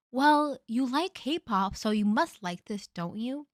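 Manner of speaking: a sing-song parody of a clueless gift giver; mocking partner voice. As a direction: drag it out as a condescending partner. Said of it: "And you're just like".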